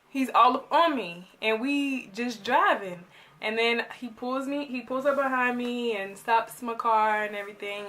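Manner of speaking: ordinary talk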